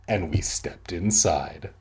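usual speaking voice

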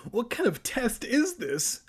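high-pitched shrieked question; shrill-pitched indignant demand. A narrator's reading. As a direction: squeal it in protest. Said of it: "Evan shrieked".

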